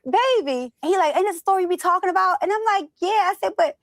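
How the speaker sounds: excited. Retold or imitated. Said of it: "excited retelling, laughing".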